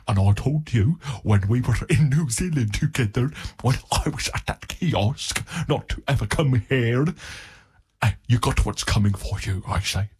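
hammed-up South African threat; South African accent. Local threatens a newcomer over his turf, in a South African accent. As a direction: play a menacing local in mock South African. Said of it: "South African accent".

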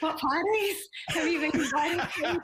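laughing quote. Retold or imitated. Cheekily asking the gossip desk for party invites, laughing. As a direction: laugh through the question.

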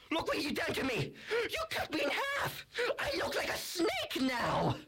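high, shouted indignant complaint; outraged monster. Acted. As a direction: rant in high-pitched mock outrage. Monster rages at being halved.

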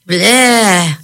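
contained storyteller's scream; startled scream. A narrator's reading. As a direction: let out a high, wordless yelp. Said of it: "Mister Thrain screamed".

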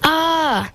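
high, restrained "ah" of pain; a Viking's yelp, read without much volume; mild acted yell. A narrator's reading. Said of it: "Helga heard a Viking yell".

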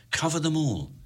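plain reading voice

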